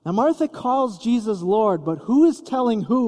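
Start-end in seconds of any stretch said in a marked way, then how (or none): none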